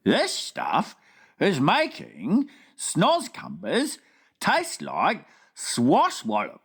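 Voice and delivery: lightly voiced friendly giant, slow wondering question; BFG voice. Giant asking the Queen at breakfast, wondering. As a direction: puzzle it out like a big gentle giant.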